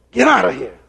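a touch of an irritated man shooing flies; mildly imitating a vexed order to get out; lightly voiced, annoyed brush-off. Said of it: "Got real vexed".

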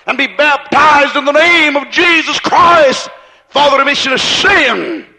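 building from slow to a ringing shout; preacher driving toward John's baptism; full-force preaching. Acted. Sermon climax calling for baptism.

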